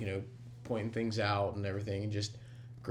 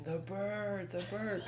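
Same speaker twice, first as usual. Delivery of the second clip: put-on kids screaming; 9 semitones higher, 4 dB quieter, slower than usual. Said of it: "they're just screaming".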